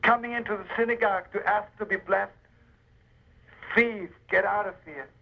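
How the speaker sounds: stern rabbi scolding a thief in the synagogue, angry; angry rebuke. Acted. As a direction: throw the thief out in a burst of anger. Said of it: "He got up in anger".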